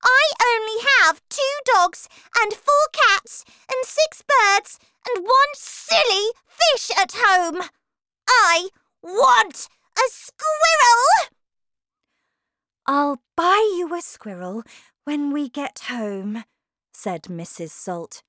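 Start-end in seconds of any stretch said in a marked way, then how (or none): 0.0-11.0 high, loud bratty rant; piercing little-girl protest; spoilt child shouting. Acted. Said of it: "shouted Veruca". 12.9-16.4 placating mother. Acted. Mother placates screaming daughter, acted.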